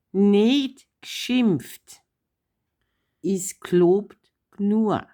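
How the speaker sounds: slow, drawn-out Bavarian "nicht geschimpft, ist gelobt nur"; Bavarian saying. Shown as a demonstration. Daughter teaching Papa the Bavarian rule, slow demo. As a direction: lay out the Bavarian saying slowly, word by word. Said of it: "Jetzt kommt das sechste Grundgesetz".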